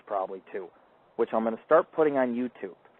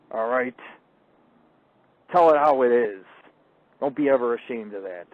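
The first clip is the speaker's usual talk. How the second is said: ordinary delivery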